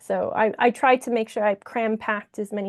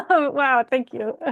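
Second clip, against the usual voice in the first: ordinary delivery, nothing marked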